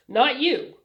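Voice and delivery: sharp, scornful and loud; contempt. Acted. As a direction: spit it out with contempt. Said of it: "with a tone of contempt".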